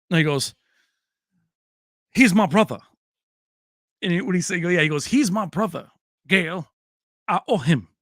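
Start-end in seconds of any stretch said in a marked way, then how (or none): 2.1-2.8 lightly voiced Spanish-Texas accent, loud; imitating Pacino's accent. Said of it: "doing, like, the Spanish slash, like, Texas". 5.0-7.9 a hint of a Spanish-Texas accent, emphatic; imitating Pacino's accent. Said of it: "doing, like, the Spanish slash, like, Texas".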